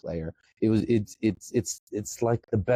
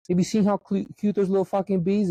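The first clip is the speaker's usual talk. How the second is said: put-on much higher, baby-talk gush over the bees; cutesy gushing; 11 semitones higher than usual. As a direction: coo about the little bees like they're puppies.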